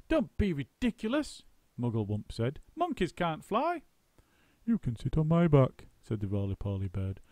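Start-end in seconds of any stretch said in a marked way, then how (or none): 0.1-1.4 high, chattering monkey; a monkey's quick squeaky voice; Muggle Wump. A narrator's reading. Muggle Wump questioning the roly-poly bird. 2.8-3.8 high, chattering monkey; a monkey's quick squeaky voice; Muggle Wump. A narrator's reading. Muggle Wump questioning the roly-poly bird. 4.6-5.9 lightly voiced bird; a friendly bird's lilt; chirpy roly-poly bird. A narrator's reading. Roly-poly bird warning Muggle Wump gently.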